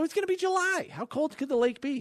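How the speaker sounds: put-on very high, disbelieving kid; mock the warning like a know-it-all teen; scoffing kid voice. Young self voiced in story.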